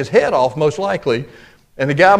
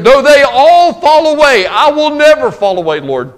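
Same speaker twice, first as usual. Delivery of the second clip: bold vow; 6 semitones higher, 5 dB louder than usual. Retold or imitated. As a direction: swear it like you mean it to the end. Peter's bold vow, acted.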